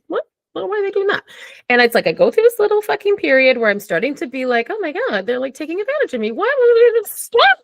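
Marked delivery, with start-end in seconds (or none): 0.1-1.2 high-pitched puzzled self-talk; mock bewilderment. Acting out dawning puzzlement. 4.7-7.6 high, hurried fretting rising to a loud wail; mock exasperation. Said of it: "And then I get mad".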